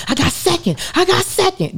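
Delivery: high, thrilled shout of I got second; excited disbelief. Retold or imitated. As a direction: squeal it out like you can't believe it. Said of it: "And I'm like".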